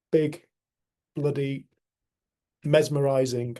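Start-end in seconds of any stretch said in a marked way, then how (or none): none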